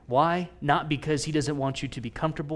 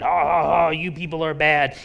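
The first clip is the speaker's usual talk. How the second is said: angry man; 2 semitones higher, 7 dB louder than usual. Retold or imitated. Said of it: "this angry man going".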